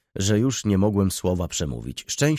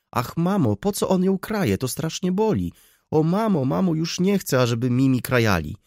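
put-on much higher, wailing child's plea; tearful outburst; 9 semitones higher, 3 dB louder than usual. A narrator's reading. Helenka pleading for her doll, crying out tearfully. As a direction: beg mother through acted tears. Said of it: "wybuchnęła płaczem".

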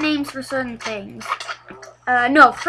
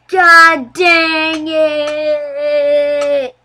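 drawn-out exasperated slow groan, louder; frustrated groan; 2 semitones higher, 7 dB louder, much slower than usual. Real, not acted. Gamer groans at the game after a death, drawn-out and slow. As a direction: stretch it out like you just died again.

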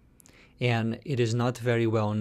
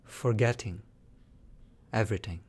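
guided meditation. Shown as a demonstration. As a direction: lead them calmly, letting each phrase settle into silence.

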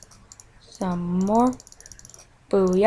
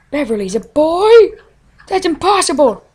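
mock shock; 6 semitones higher, 4 dB louder than usual. Mock disbelief.

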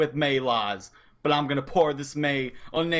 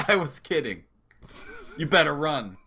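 ordinary talk, nothing marked

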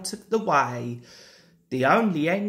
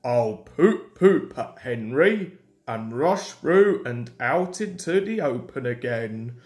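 lightly voiced big engine, lower; a hint of engine voice, pitched down; Gordon's scheming thought; 6 semitones lower than usual. A narrator's reading. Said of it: "he thought".

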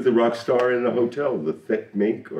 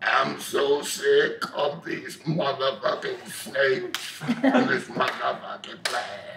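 demon voice; 6 dB quieter, slower than usual. Acted. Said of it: "your best demon acting".

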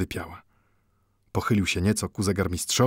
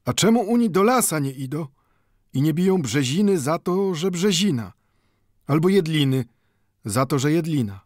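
Mendel, higher, slyly mocking; sly retort; 8 semitones higher, 4 dB louder than usual. A narrator's reading. Mendel slyly answers the watchmaker, higher voice. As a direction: turn the question back on him, higher.

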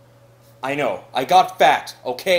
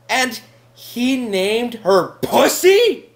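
very slow, spaced-out telling, louder at the end; incredulous; 3 semitones higher, 6 dB louder, much slower than usual. Acted. Housemate appalled at the cat's name, slow incredulous delivery. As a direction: dole out each word in disbelief.

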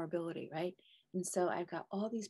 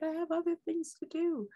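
high, louder whiny protest; arguing with intuition; 12 semitones higher, 6 dB louder than usual. Retold or imitated. She argues with her intuition in a high whiny protest. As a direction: push back like a sulky child. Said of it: "I argued with that intuition".

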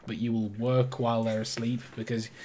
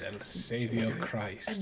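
ordinary talk

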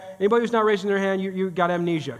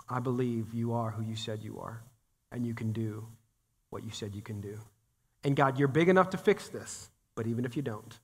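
hushed prayer; 10 semitones lower, 10 dB quieter than usual. Acted. Voices a faith-filled prayer.

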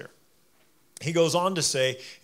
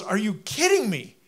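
exasperated; 6 semitones higher, 3 dB louder than usual. Retold or imitated. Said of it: "And he goes".

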